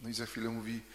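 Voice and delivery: ordinary delivery, nothing marked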